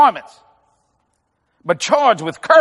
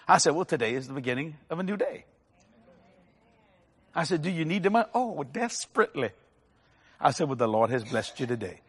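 much softer, lower storytelling; quiet retelling; 7 semitones lower, 11 dB quieter than usual. Real, not acted. Preacher quietly recounting the tip, low and soft.

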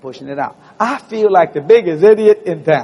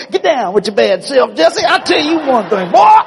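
higher and louder, laughing shout; gleeful boast; 8 semitones higher, 5 dB louder than usual. Retold or imitated. Preacher gloats at the gate after the explosion, laughing shout. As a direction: crow about it with joy.

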